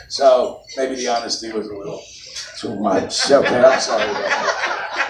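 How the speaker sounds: usual speaking voice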